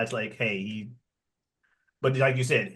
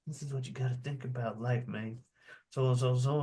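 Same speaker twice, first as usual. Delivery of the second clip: hushed, understated imitation of earnest advice; imitated mentor talk; 10 dB quieter than usual. Movie mentor talking to his protégé outside the cell, hushed.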